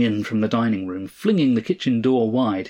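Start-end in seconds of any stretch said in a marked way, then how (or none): none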